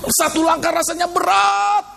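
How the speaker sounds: haul each word out as if under a heavy load; straining lament. Acted. Preacher voicing how hard one step feels, straining lament.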